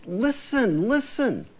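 pleading. Retold or imitated. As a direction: beg them to listen. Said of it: "It's like he's pleading".

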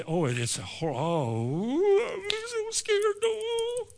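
badly faked fright, high and soft; mock fright. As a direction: squeal it like a coward. Mocks the scared Israelites.

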